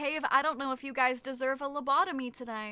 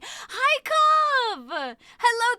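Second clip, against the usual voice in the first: excited shout; 9 semitones higher, 9 dB louder, much slower than usual. Real, not acted. Greeting a supporter.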